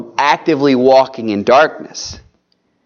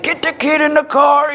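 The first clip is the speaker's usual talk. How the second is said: mock yell; 14 semitones higher than usual. Husband rushing his wife before church, mock yell. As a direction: snap it out like a harried dad. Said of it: "we're yelling at the wife".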